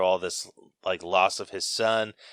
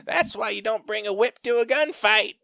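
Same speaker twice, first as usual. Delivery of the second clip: high mocking old lady voice; hammed-up cackling Southern old lady; old lady in the theater mocks Indy, high and teasing; 12 semitones higher, 3 dB louder than usual. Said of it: "hear this old lady cackle".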